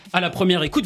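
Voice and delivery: normal talking voice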